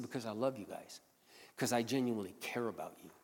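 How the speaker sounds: soft, earnest declaration of care; tender sincerity. Retold or imitated. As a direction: say it gently, meaning every word. Quiet sincere declaration to coworkers.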